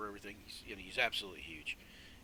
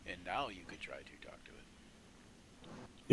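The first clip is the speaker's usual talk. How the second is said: hushed aside; 5 semitones lower, slower than usual. Acted. GM whispering a prompt to players.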